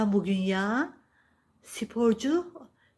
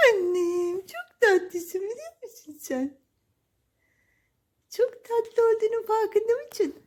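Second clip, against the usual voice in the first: high, cooing pet-talk voice; baby talk to cat; 12 semitones higher than usual. Acted. Owner cooing to her cat, high baby talk. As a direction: gush over the cat like a baby.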